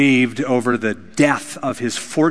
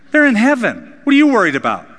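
heartless brush-off; 8 semitones higher, 4 dB louder, faster than usual. Pretend. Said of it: "so cruel and heartless".

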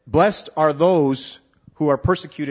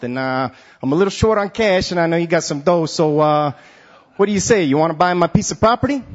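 loud, high Brooklyn street guy; Brooklyn accent; 3 semitones higher, 4 dB louder than usual. Retold or imitated. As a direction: pitch it like a Brooklyn hustler. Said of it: "had a Brooklyn accent".

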